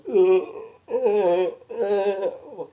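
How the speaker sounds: loud, high drawn-out strangled "uh"; contorted moaning grunts. Shown as a demonstration. Patient contorted before mother and aunt, strangled moaning. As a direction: groan helplessly with a seized-up tongue. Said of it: "I was just all contorted and going".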